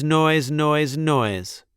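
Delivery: ordinary delivery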